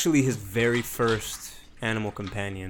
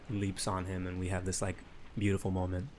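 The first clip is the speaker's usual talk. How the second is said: normal talking voice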